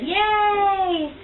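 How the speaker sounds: put-on high, cheery baby-style yay; imitating baby's yay. Mother imitates her baby clapping, high mock cheer. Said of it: "claps her hands and says".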